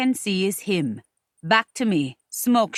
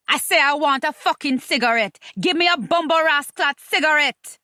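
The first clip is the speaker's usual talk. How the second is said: higher-pitched, cursing Jamaican insistence; angry demand; 5 semitones higher than usual. Acted. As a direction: demand it, fed up and furious. Woman angrily demands a cigarette.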